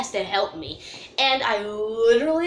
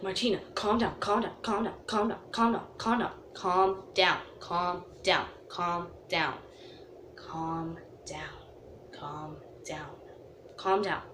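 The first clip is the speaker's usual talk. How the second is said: soft, fading to almost nothing; calm her down, quieter each time; soothing, fading; 5 semitones lower, 9 dB quieter than usual. Retold or imitated. Friend soothing her, repeated.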